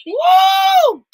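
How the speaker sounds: high, loud, held woo; excited shout. Real, not acted. Host shouting with joy over the five-book giveaway. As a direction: shout it out in celebration. Giving away five books.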